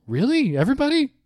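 high, rising disbelief; stunned old man asking; astonished. Retold or imitated. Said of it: "He goes".

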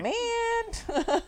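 high, loud drawn-out letdown; high-pitched mock groan of dismay. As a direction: wail it in hammed-up disappointment. Acts out disappointed reaction to villain.

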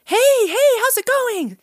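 very high, hyped-up greeting, imitating a friend; mimicked over-the-top peppy hello; super energetic. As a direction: burst in bubbly, pitch way up. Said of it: "He's, like, always energetic".

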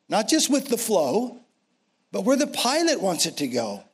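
rising preacher's build, high; preaching at full force. Real, not acted.

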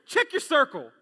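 put-on loud and firm, like disciplining a child; forceful command. Shown as a demonstration. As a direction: scold your neighbour with it. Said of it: "kind of some force, as if you were disciplining your children".

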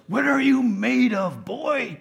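high, loud, slow mock coach bark; hammed-up demanding coach yelling at the player; yelling head coach. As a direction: bark it like a drill coach in your face. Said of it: "who gets in our face and yells".

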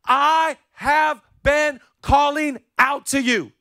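Holy Spirit calling out. Acted.

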